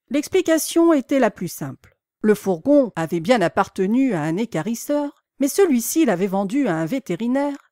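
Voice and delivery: usual speaking voice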